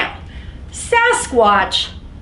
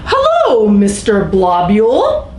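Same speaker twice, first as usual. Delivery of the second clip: hammed-up polite creature greeting; sasquatch voice; 8 dB louder than usual. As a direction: greet the bus driver brightly. Sasquatch greets bus driver.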